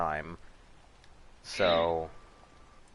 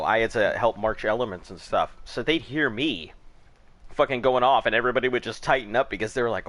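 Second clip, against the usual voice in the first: ordinary delivery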